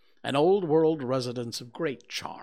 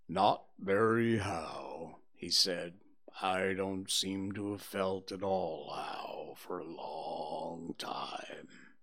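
low, slow, whispered and mournful; gloomy donkey Eeyore; 6 semitones lower, 5 dB quieter, slower than usual. Acted. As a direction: sigh it out like a sad old donkey.

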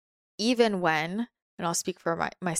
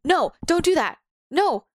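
loud, high, sharp no; fearful ego; 9 semitones higher, 5 dB louder than usual. Retold or imitated. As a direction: bark it out like a scared ego stopping you. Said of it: "let's say my ego".

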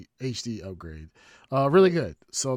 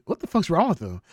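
higher, louder retold outburst; imitated wife's bewilderment; 7 semitones higher, 7 dB louder than usual. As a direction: blurt it out, imitating a baffled wife. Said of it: "she's like".

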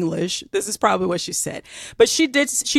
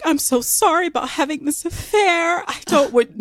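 much higher, contrite mock Southern accent; mock apologetic Southern; 8 semitones higher than usual. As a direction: beg pardon in a hammed-up Southern accent. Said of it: "she tried to be like".

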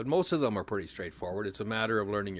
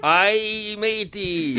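high, loud hammed-up pirate; punchline pirate voice, big and playful; 11 semitones higher, 7 dB louder, much slower than usual. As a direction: growl it out like a joking pirate. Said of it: "What does a pirate say".

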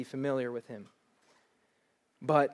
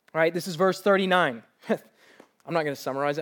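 ordinary delivery

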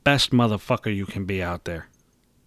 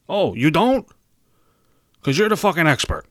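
louder, higher, biting sarcasm; indignant and sarcastic, pitched up; sarcastic retort; 7 semitones higher, 3 dB louder than usual. Acted. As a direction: throw it back as a mock question. Sarcastic retort to doubting person.

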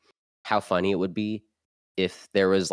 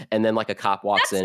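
normal talking voice

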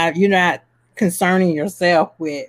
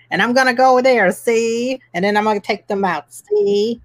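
put-on old-time gangster voice, see; fast, high 1930s tough guy; James Cagney impression; 5 semitones higher than usual. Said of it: "That's our impression of James Cagney".

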